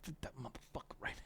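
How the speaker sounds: soft unvoiced whisper; hushed aside. Real, not acted. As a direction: whisper it to yourself.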